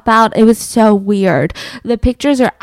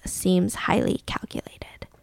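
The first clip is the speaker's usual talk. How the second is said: softer, slow, whispered verdict; hushed; 4 semitones lower, 12 dB quieter, slower than usual. Retold or imitated. Psychiatrists telling the court, slow hushed whisper. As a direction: breathe it out like a grave secret.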